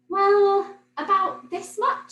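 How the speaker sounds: put-on bright child's "well"; Millie's voice. A narrator's reading. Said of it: "said Millie".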